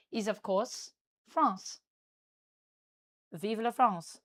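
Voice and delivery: put-on Parisian-style French accent. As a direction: speak English as a Parisian would, with a French accent. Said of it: "but this is my French accent".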